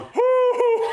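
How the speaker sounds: high loud hoot, hoot, hoot; repeated owl hoots. Retold or imitated. The other old man answering from his dock, high loud hoots. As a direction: keep hooting like an owl calling back.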